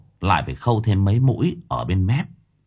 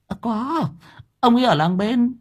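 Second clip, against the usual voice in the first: wife's voice; 13 semitones higher than usual. A narrator's reading. Wife answers, voiced higher.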